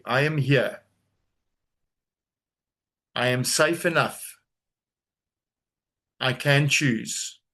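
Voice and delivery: slow, measured words with long pauses; unhurried mantra, phrase by phrase; slow affirmation. Shown as a demonstration. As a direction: say it slowly for others to repeat. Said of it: "say this slowly".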